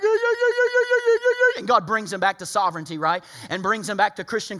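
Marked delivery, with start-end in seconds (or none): 0.0-1.6 mock crying. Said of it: "they're crying, like".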